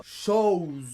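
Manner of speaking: « chose » dit « chaouse », traînant; O diphtongué. Shown as a demonstration. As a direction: glisser vers OU dans chose. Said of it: "ça fait faute". (« chose » à la québécoise)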